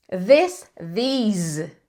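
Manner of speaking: "This" short with S, "these" long EE with Z; short I then long EE; this versus these. Shown as a demonstration. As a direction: contrast "This" and "these". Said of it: "This termina com som de S".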